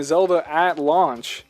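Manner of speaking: ordinary talk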